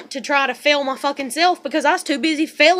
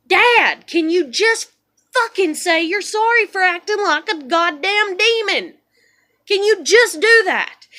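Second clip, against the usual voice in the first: confronting dad; 6 semitones higher, 6 dB louder than usual. Retold or imitated.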